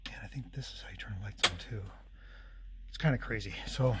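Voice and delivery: fast unvoiced whisper. Real, not acted. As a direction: whisper it quickly. Shows two ways to turn lights on.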